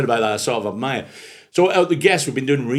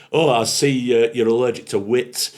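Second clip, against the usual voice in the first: louder mock-cultured comeback; retold drunk's retort; 3 semitones higher, 6 dB louder than usual. Scottish drunk to humourless rug carrier, retold arch retort. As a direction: lightly play the witty drunk. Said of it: "this Scottish guy just goes".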